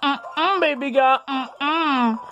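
high-pitched mock teacher hums; imitated teacher. Student imitating the sixth period teacher's talk, high and comical. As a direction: mimic a sassy teacher in a high put-on voice. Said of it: "How she talks, she talks like".

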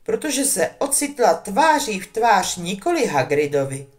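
ordinary delivery, nothing marked

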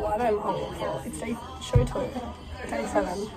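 normal talking voice

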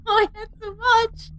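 crying laugh. Real, not acted. Lost everything, crying laugh.

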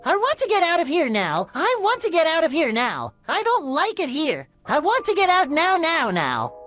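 very high shrieking cartoon yell; angry scream. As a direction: scream to be let out of the cell. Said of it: "He screams angrily".

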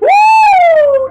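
high, loud whoop; excited whoop. Real, not acted. Player cheering mid-game, excited high whoop.

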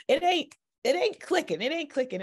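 high-pitched, exasperated complaint; retold frustration. Worshiper complaining to God while driving, frustrated. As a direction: replay your frustration, pitched well up. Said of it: "but I was frustrated".

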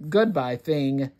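usual speaking voice

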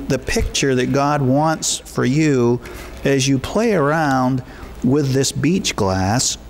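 ordinary talk

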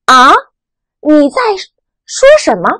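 啊 rising like a puzzled question. Shown as a demonstration. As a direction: ask 啊 with a rise, not catching it. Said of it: "戴上第二顶帽子的时候". (啊，第二声，疑问语气)

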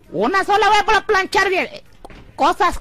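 high, loud grumble; mock nanny. Voicing own nanny.

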